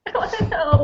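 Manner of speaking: mock whining. Reader commiserates about the untold secret, mock whining. Joins the playful complaint.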